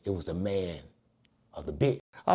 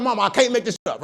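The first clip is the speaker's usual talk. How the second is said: ordinary talk, nothing marked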